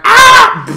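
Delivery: mock high scream of pain; imitated monkey scream. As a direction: shriek as the robot monkey falls. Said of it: "He's like".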